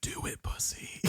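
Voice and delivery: hushed, goading whisper; taunting whisper. Acted. The Thing goads Blair in a hushed, goading whisper. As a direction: whisper the taunt like a hidden tempter.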